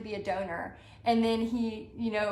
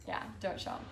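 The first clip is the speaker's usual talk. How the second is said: breathy whisper off to the side; whispered aside; 11 dB quieter, faster than usual. Real, not acted. Whispering to someone off camera to keep the surprise. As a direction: hush it so the viewers can't hear.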